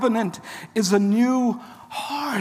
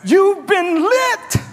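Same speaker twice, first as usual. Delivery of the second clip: much higher, louder, slow "lit" shouted; preached shout; 10 semitones higher, 5 dB louder, slower than usual. Acted. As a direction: shout it out like a revelation. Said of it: "Jesus is saying this".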